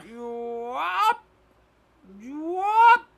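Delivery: high, loud, eerie call of You up; an unseen voice calling out in a strained high tone; spooky voice calling into the dark. Acted.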